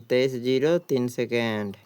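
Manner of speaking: ordinary delivery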